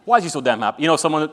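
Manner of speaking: usual speaking voice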